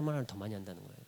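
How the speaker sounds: ordinary talk, nothing marked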